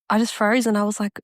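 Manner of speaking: ordinary delivery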